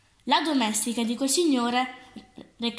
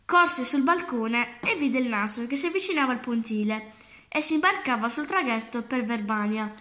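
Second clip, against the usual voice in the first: ordinary delivery, nothing marked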